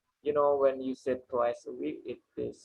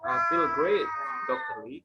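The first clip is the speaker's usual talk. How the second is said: much higher, "feel" stressed; bright high teacher model of the line; model sentence stress. Shown as a demonstration. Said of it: "Just repeat after me".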